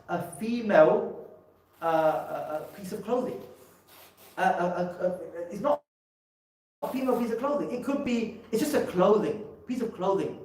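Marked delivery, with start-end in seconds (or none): none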